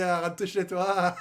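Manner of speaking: slow, laughing go home; mocking laughter at the rival fans; laughing taunt. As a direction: laugh it out as you send them home. Taunting Lyon fan, laughing.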